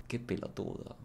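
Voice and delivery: soft, low "Qué pelotudo", breathed out; quiet deadpan put-down; unimpressed murmur. Shown as a demonstration. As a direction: say it with tired disdain. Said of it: "para que llegue el".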